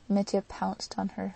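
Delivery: plain reading voice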